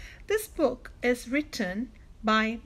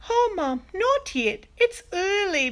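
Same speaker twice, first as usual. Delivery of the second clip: lightly acted children's groan, high and louder; groaning protest; 8 semitones higher, 6 dB louder than usual. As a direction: protest like kids sent to bed, pitched up. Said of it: "we groaned".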